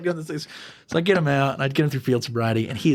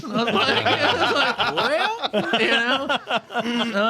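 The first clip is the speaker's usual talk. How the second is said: laughing; 11 semitones higher, much slower than usual. Real, not acted. Laughing while retelling reply.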